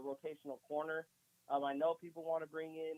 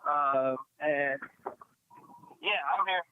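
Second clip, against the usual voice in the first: bluetooth headset mic; 8 dB louder than usual. Real, not acted. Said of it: "Bro, you there?".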